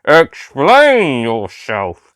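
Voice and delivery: very slow, flat robotic drone; robotic Cyberman voice. A narrator's reading. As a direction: speak like a cold machine, word by word. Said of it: "slightly human-like robotic response".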